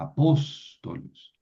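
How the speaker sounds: a-POS-to-los, POS drawn out; slow demonstration of "apóstolos" with the weight on POS; antepenultimate stress. Shown as a demonstration. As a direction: say "apóstolos" slowly, holding the "pós". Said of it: "Antepenúltima".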